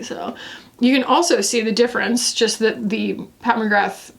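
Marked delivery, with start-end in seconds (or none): none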